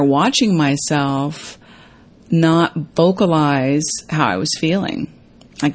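normal talking voice

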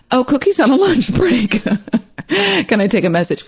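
high, sing-song receptionist imitation. Acting the different receptionist.

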